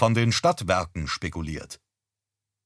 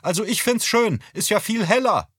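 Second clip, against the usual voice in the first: much higher, cheerful voice; mother's voice; 12 semitones higher, 3 dB louder than usual. Acted. Mother tells her husband she likes it, high and cheerful.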